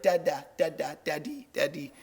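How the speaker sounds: lightly imitating a baby calling dada; a hint of a toddler babbling for daddy. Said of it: "she'd be like".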